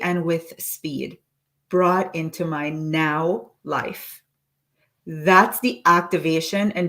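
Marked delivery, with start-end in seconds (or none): 0.0-4.2 slow dictation. Shown as a demonstration. Coach slowly reading the full affirmation aloud. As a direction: let each word land slowly. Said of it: "read the full statement again".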